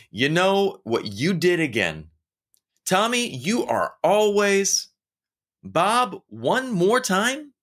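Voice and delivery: high, exasperated nagging at a coworker; mock scolding. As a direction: scold him like a fed-up boss. Harsh feedback scolding a coworker.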